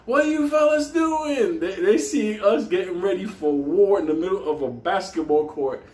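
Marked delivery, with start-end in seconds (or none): 0.0-1.6 mock neighbor yell. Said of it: "people from the windows are screaming".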